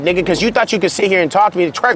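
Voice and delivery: usual speaking voice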